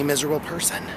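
normal talking voice

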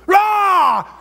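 a huge high shout, a blast of sound; sudden shouted rah. Retold or imitated. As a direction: burst out with a loud sharp rah. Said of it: "and he goes".

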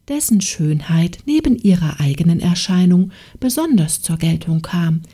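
usual speaking voice

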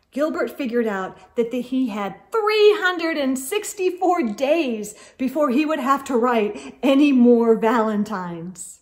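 plain reading voice

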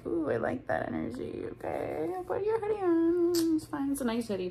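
high-pitched coy acting-out; mock-reluctant, high flirty voice; coy flirty reply.

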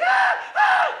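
two very high, loud screams; mock shrieks of a woman being attacked; imitated woman's screams. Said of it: "she's like".

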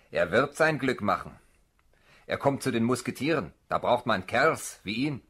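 loud, bluff approval; loud recruiting officer; hearty officer. Acted. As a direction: boom it at the recruit.

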